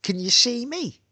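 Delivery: high imitation of a woman asking; imitating ex-girlfriend. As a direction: mimic her question, pitched right up. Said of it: "And she goes".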